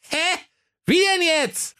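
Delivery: very high, squeaky puzzled dwarfs; dwarfs calling out. Acted. Dwarfs question the tortoise's riddle, squeaky and baffled. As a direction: pipe up in high-pitched bafflement. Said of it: "riefen die Zwerge".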